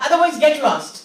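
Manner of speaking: angry dismissal. Acted. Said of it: "got angry".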